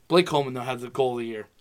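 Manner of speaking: usual speaking voice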